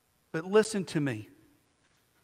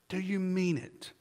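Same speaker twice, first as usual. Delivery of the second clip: softer and slower, pressing the question home; quiet, slow repeat; 9 dB quieter than usual. Real, not acted. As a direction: ask it again quietly, letting it sink in.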